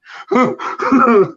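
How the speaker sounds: loud high laugh; high burst of laughter. Real, not acted. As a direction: break into a sharp laugh. Laughs at own joke.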